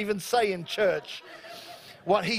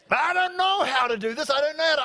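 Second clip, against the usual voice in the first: high-pitched, comic 'I can't' voice; exaggerated high voice of self-doubt; odd put-on doubter voice; 6 semitones higher, faster than usual. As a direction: play a helpless congregant, pitched way up. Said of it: "I don't know why I'm talking like this".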